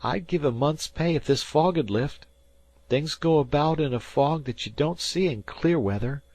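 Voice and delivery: he swears the dead man came back; spooked fisher boy. A narrator's reading. Dan to Harvey, spooked high boy voice. Dan talks of fog ghosts.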